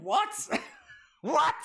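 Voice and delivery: high-pitched, incredulous yelp; astonished. Real, not acted. Streamer learning of the question mark gimmick, astonished. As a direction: burst out in disbelief. Reacting to chatter's odd gimmick.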